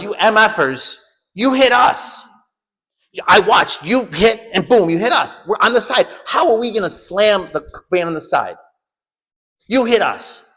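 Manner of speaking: high, furious accusation; retold yelling. Yelling at the corrupt cops, agitated retold yell. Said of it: "I was yelling at them".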